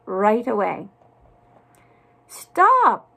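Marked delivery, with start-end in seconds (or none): 2.3-3.1 high, loud shout after a runaway; storybook cook yelling to come back; crying out. A narrator's reading. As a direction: call out urgently, pitched right up. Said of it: "cried the cook".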